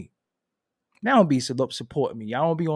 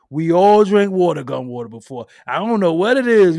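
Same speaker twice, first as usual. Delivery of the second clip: loud insistence; 4 semitones higher, 10 dB louder than usual. Real, not acted. Insisting everyone drank it.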